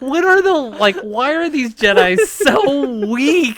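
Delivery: high, incredulous exasperation; mock outrage at weak Jedi; exasperated. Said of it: "I was like".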